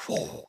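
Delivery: soft unvoiced whoosh of breath; breath blown out. Preacher miming God's breath over the bones, unvoiced whoosh. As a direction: blow it out like breath of life. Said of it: "he prophesies the breath".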